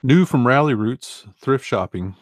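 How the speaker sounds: light promo read of the app alert; mock ad announcer. Reading out a phone notification.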